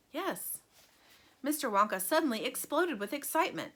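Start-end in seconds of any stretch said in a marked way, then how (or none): 0.1-0.6 soft, high little yes; hushed childlike yes; quiet yes. A narrator's reading. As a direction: breathe it out softly. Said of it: "whispered Charlie".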